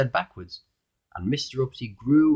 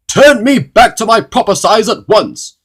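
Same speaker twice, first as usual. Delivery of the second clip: high, very loud, slow furious demand; spluttering rage; 11 semitones higher, 13 dB louder, slower than usual. A narrator's reading. Shrunken Mister Uppity to the goblin, raging shout. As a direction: splutter it out at full outrage. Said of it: "spluttered Mister Uppity in a rage".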